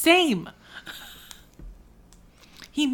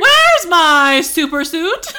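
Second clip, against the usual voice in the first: movie quote; 3 semitones higher, 8 dB louder, slower than usual. Retold or imitated. Quoting the Incredibles husband.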